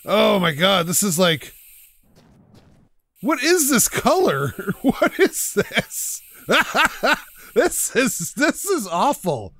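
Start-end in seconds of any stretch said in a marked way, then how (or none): 3.2-9.5 laughing. Real, not acted. Laughing at an ugly car color.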